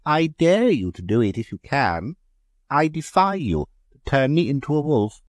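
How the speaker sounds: high, loud provocative dare; daring taunt. A narrator's reading. Mary daring Leonard from the conservatory, taunting. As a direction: goad him into trying. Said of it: "laughed Mary provocatively".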